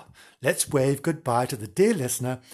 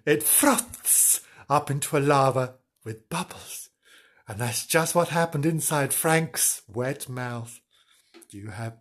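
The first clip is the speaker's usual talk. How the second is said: ordinary delivery, nothing marked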